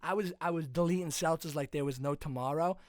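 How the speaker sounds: put-on brash drunk-bro Boston; Massachusetts accent. Drunk masshole recounting seltzers and a fight, brash Boston accent. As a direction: tell the bar fight in broad Boston swagger. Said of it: "I just mastered the guy's accent".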